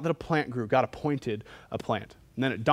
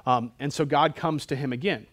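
ordinary talk

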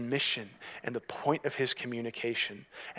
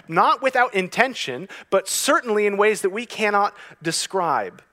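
loud, raised-pitch preaching; impassioned preaching; 7 semitones higher, 10 dB louder than usual. Real, not acted. Preacher applying his quirk to life with God, impassioned. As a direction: drive the point home to a congregation.

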